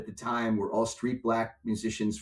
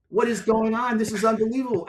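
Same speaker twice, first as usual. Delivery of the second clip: retold panic; 9 semitones higher, 4 dB louder than usual. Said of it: "I was like".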